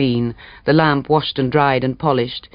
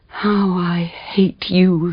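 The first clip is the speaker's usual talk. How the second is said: higher, very slow hateful line; slow bitter hatred; 5 semitones higher, much slower than usual. Acted. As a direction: spit it out slowly, bitter.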